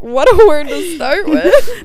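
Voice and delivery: mock shocked. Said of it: "I was like".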